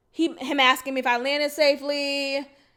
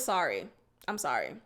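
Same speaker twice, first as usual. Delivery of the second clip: Sorry and I'm sorry much lower and softer; bare quiet apology; 11 semitones lower, 9 dB quieter than usual. Shown as a demonstration. What she actually texted him, low and soft sorry. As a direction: drop the voice, just a plain small sorry. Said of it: "All I said was".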